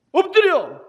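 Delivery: shouted high robber's order; robber's command. Retold or imitated. Robber bursting into the shop, shouting orders at the brothers. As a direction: bark it like an armed robber.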